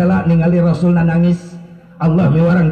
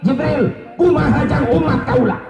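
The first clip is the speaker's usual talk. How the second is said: high, urgent pleading question; anguished plea; 9 semitones higher than usual. Retold or imitated. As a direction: press the question on a high, sustained pitch. Retold Prophet pleads for his people. (nanya bari melas, sora luhur)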